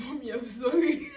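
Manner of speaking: mock-tearful plea broken by laughter; laughing apology. Daughter re-enacting her apology to mom, half laughing, half mock crying. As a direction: plead sorry while cracking up.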